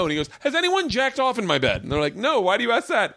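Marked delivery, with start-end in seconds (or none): 0.4-1.8 much higher, squeaky disbelief; high incredulous dad. Retold or imitated. Dad asking his sons a crude question, high-pitched and incredulous. 2.1-3.1 much higher, mock-innocent; high innocent sons. Sons denying to their dad, high and mock-innocent.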